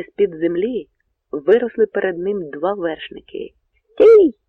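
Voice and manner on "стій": high, very loud command; sudden shout. A narrator's reading. Horseman grabs Bohun's reins, sudden high shout. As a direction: yell it to halt a rider. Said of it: "крикнув хтось".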